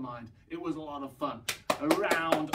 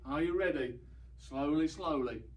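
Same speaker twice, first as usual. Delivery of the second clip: ordinary delivery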